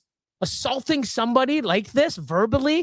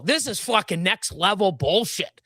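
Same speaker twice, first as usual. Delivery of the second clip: normal talking voice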